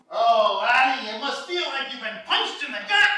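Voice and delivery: put-on high, loud old Scottish accent; old Scottish man. Old Scottish guy consoling the losing golfer, Scottish accent. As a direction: play an ancient, stooped Scottish local. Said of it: "this old, ancient Scottish guy".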